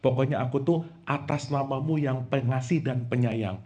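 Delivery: soft, mumbled mock prayer; hushed lazy petition. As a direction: mumble it carelessly to God. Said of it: "Lagi sholat ngomong".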